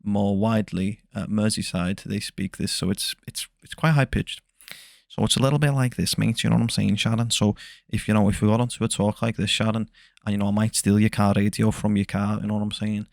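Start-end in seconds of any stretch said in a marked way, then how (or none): 3.6-13.1 Liverpudlian accent. Shown as a demonstration. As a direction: talk like someone from Merseyside. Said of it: "a Liverpudlian accent".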